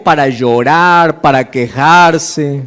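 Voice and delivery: drawn out; lingering on quejarse; slow, falling quejarse. Shown as a demonstration. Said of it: "para quejarse en Facebook".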